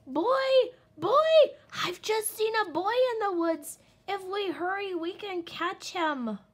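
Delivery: very high, very loud howl. Acted. Little wolf running home to his parents, loud high howl. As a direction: howl the alarm across the woods. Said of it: "howling all the way".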